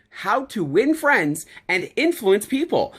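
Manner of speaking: slow, deliberate delivery of each word; emphatic repeat. Shown as a demonstration. Speaker repeating a book title so it sinks in, slow and emphatic. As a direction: stretch out "How to Win Friends and Influence People" for emphasis. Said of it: "Say it again".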